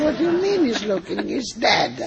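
high, fast, amused disbelief; mock-astonished, brushing off the fear; incredulous retort. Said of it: "I said".

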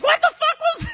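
put-on high loud baby shout, laughing; outraged tipsy toddler; drunk baby voice. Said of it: "still doing the drunk baby voice".

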